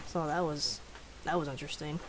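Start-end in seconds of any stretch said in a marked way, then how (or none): none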